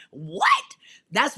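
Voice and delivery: loud rising What; preacher's shout. Real, not acted. Preacher calling out to the crowd, loud rising What. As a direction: punch it out to rouse the crowd.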